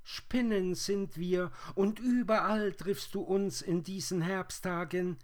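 very high, loud spider squeal; shrill calling voice, far above natural pitch; strange high voices. A narrator's reading. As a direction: answer as tiny whirring creatures in chorus. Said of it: "antworteten die fremden Stimmen".

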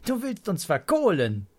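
high, loud gleeful accusation; playful childlike protest; amused child. A narrator's reading. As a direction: call out grandpa's fib in delight. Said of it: "Pia und Pitt kichern".

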